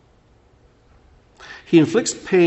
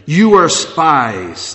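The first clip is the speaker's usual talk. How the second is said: loud, forceful mock accusation; harsh accusation; 4 semitones higher, 8 dB louder, much slower than usual. Joseph acting like a stranger toward his brothers, harsh. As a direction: hit them hard with the charge. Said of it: "spoke with them harshly".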